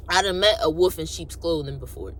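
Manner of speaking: ordinary delivery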